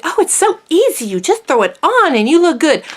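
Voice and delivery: high, breezy mock-cheerful voice; mocking everybody. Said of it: "everybody's just like".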